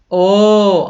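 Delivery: loud, drawn-out open "o", like ó; open stressed O. Shown as a demonstration. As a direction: hold the "o" wide and strong. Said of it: "pra dizer que eu falo assim".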